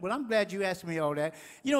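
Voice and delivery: normal talking voice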